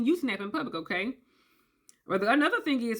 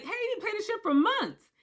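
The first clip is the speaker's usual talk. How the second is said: pushy friends; 9 semitones higher than usual. Retold or imitated. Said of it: "It's like".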